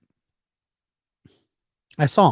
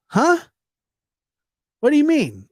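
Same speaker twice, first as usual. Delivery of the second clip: high, louder confused question; baffled coworker; 14 semitones higher, 5 dB louder than usual. Retold or imitated. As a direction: play him totally lost. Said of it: "And he goes".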